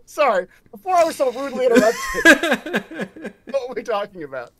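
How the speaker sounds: loud, quick, high comic voice; resume the chat as if rudely cut off; mock indignant chatter. Game master playing along with the joke, loud mock huffiness. Joins the interrupted-conversation joke.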